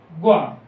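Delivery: ग्वा said as one gliding syllable, gwa, firm and falling; compound consonant gwa. Shown as a demonstration. Teacher quizzing the class, firmly saying ग्वा. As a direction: blend g and w into ग्वा, short and falling. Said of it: "कौन सा?".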